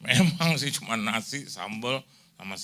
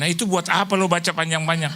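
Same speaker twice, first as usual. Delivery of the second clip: higher, louder outburst; mock exasperated protest; 6 semitones higher, 5 dB louder than usual. Young listener protests pastor's long reading, mock exasperated outburst. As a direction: burst out complaining at the reader.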